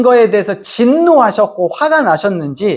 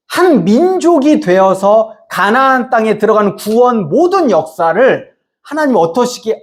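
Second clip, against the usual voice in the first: ordinary talk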